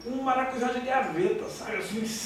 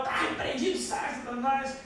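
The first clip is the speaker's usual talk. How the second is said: mock fiery rebuke of Satan, pitched up; zealous believer; 4 semitones higher, slower than usual. Young believer rebuking his mother's house, mock fiery. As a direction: play the pushy young believer casting out demons. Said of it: "Era daquele, daquele crente".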